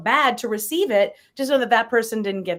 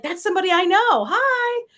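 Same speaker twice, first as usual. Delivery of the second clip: cheery hello; 10 semitones higher, 3 dB louder than usual. Retold or imitated. Friendly greeting quoted.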